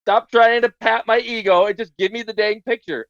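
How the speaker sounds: exasperated. Retold or imitated. User telling the AI off for flattery, exasperated. Retelling his annoyed reply to AI.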